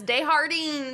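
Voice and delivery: Desjardins stretched as day-har-DEENS; rhymes with sardines. Shown as a demonstration. Mocking the film's name, Desjardins as day-har-deens. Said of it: "Rhymes with sardines".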